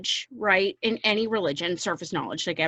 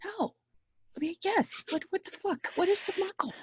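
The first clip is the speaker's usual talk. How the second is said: squeaky confused self; 10 semitones higher, 10 dB quieter than usual. Retold or imitated.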